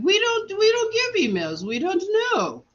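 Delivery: very high, mimicking voice; imitated nurse. Nurse refusing to give out emails, very high mock helpless voice. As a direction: put on a high-pitched nurse's excuse. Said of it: "she came back and she said".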